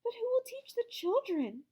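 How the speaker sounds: worried librarian. A narrator's reading. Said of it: "she asked".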